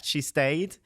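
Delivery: ordinary talk, nothing marked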